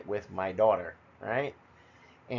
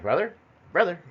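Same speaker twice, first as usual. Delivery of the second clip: raised, higher call across the room; 8 semitones higher, 5 dB louder than usual. Retold or imitated. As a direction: call your brother, looking around. Calling to missing brother at party.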